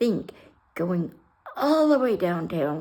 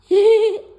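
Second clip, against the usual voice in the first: whispered laugh; 14 semitones higher, 12 dB louder than usual. Real, not acted. Laugh at own near death.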